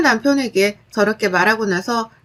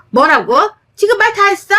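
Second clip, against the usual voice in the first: much higher, shocked furious cry; outraged; 8 semitones higher, 4 dB louder than usual. Acted. Sister-in-law blamed for her divorce, outraged cry. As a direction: explode in disbelief.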